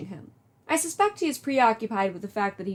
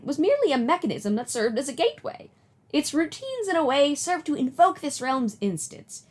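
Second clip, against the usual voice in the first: higher, doting grandmother voice; old lady voice; 4 semitones higher than usual. Acted. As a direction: play a kindly old granny. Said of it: "do an old lady voice".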